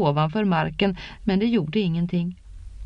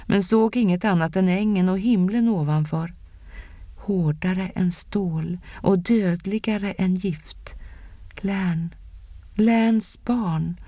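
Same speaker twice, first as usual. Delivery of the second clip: ordinary delivery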